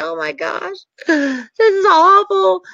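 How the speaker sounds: a hammed-up wail of dismay; mock tearful dismay. Speaker retelling the evicted families' grief, mock crying. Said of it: "It was like".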